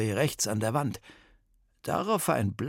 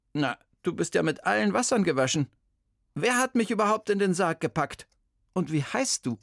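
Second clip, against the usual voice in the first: a lightly voiced indignant old lady, higher; Bertha's stern voice; 6 semitones higher, 3 dB louder, faster than usual. Old detective scolds the kidnapping boy, indignant.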